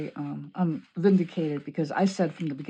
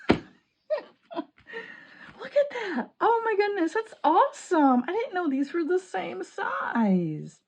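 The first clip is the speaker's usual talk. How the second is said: much higher, laughing, thrilled squeal; delighted; 13 semitones higher, 5 dB louder, slower than usual. Real, not acted. Crafter discovering the sizes match, squealing with delight.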